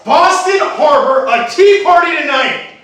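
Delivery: loud, high slogan chant; rally the crowd with a shout; hammed-up protest call; shouted rallying cry. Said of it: "Then someone shouted".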